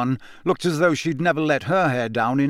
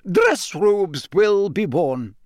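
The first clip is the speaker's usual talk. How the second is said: slow, higher, precise stern teacher; McGonagall, stern; 5 semitones higher, much slower than usual. A narrator's reading. McGonagall instructing the class, slow stern teacher voice. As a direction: spell out each word like a rule. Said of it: "McGonagall continues her announcement".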